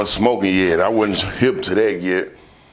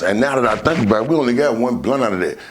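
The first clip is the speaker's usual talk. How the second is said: normal talking voice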